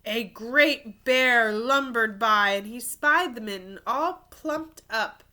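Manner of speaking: put-on lively storybook narration for kids; animated read-aloud; reading to children. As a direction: tell it like a picture book at story time. Reads picture book aloud.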